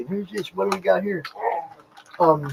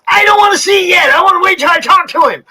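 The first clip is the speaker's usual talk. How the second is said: high, fast, whiny fan voice; whiny pleading; 11 semitones higher, 4 dB louder, faster than usual. Retold or imitated. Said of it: "it's like".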